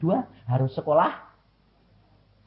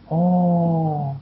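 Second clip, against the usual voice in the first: ordinary delivery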